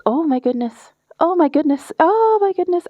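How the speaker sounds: oh my goodness, building in pitch; flustered building exclaim. Real, not acted.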